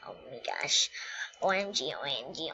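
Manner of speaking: normal talking voice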